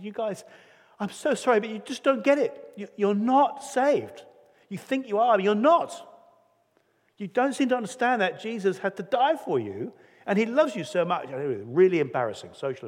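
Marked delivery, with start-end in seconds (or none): 0.0-11.3 high, loud, desperate pleading; bursting out. Retold or imitated. Penny bursting out at the wealthy couple, high and loud. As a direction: blurt it out, no longer able to hold back. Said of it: "She just burst out".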